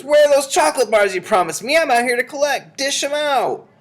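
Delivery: put-on high, loud demanding boy; boy calling through doors. A narrator's reading. Boy outside demands to come in, yelling high. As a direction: yell it as an impatient boy. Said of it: "came the boy's voice from behind the doors".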